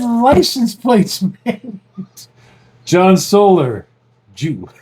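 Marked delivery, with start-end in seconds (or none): none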